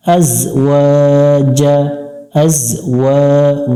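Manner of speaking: ordinary talk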